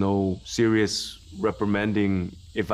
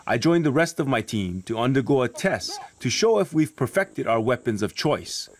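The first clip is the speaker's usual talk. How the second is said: lively, emphatic voice-over, higher than chat; documentary narration; 5 semitones higher than usual. A narrator's reading. Narrator introducing the weapons test to viewers, punchy documentary voice-over.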